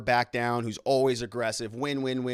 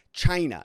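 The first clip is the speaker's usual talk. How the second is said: China hit high and loud; hard China; 4 semitones higher, 6 dB louder than usual. Shown as a demonstration. Host mimicking Trump's hard China for viewers. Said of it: "he says it like that".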